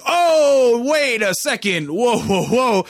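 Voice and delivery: high, drawn-out mock 'hold up'; alarmed group reaction. Everybody reacts to the offensive rhyme.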